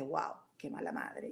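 casi en voz baja, mucho más suave, como diciéndose a sí misma "Wow, qué mala madre"; voz interior. Retold or imitated. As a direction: murmurar el reproche hacia dentro, mucho más suave. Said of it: "mi mente me dice". (la mente critica a la madre, en voz mucho más baja)

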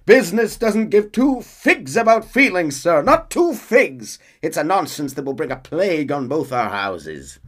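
high, loud, haughty tycoon voice; loud and high, sneering at the union man; pompous Cornwall. Retold or imitated. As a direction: scoff at him like an outraged rich man. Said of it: "Cornwall cuts him up".

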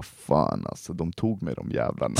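low soft grumble; low grumbling. Retold or imitated. Telling friends next day he got caught, low grumble. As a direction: groan it out, beaten and bitter.

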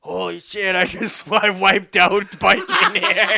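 a bloodied friend explaining his bike wipeout, laughing; laughing retelling. Retold or imitated. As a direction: play the dazed crasher while cracking up.

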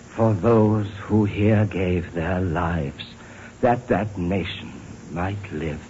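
low, measured recital with long pauses; hushed reverence of a memorial address, pitched low; solemn recitation. Acted. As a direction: recite it gravely, phrase by phrase.

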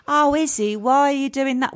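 high put-on voice for a friend; Amy's voice. Author redoing her friend's audiobook voice, high and put-on. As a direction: pitch it up into a silly audiobook character voice. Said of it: "So I made my friend Amy's voice like".

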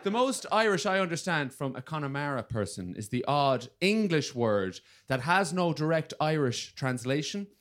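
ordinary delivery

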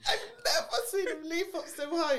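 high, excited exclamation through laughter; giddy, high-pitched delight; overjoyed retelling. Retold or imitated. Retells joy at getting the job.

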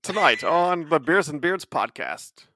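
slow, loud TV anchor read; news anchor voice. Pretend. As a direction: announce it like the evening news. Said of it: "use your best T V news anchor voice".

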